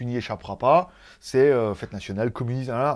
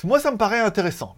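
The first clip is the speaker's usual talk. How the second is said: ordinary talk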